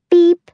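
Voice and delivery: high sharp beep; phone beep. A narrator's reading. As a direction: chirp it like a phone.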